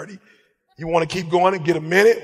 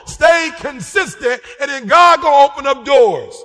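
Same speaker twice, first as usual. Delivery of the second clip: full-force preaching; 7 semitones higher, 7 dB louder than usual. Acted. Preacher urging the congregation to pray and stay consistent, fervent. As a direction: drive each line home to the crowd.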